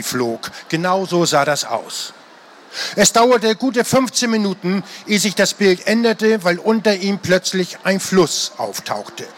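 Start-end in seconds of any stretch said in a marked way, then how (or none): none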